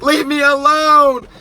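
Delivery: high, loud, drawn-out yell; hammed-up exasperated shriek; acted scream. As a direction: scream it at a clinging ex. Retold scream at clinging ex.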